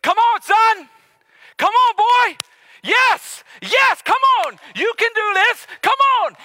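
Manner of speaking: high, loud begging cheer; pleading shout. Acted. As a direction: yell it out like a father urging his son home. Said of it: "He begins to beg and plead".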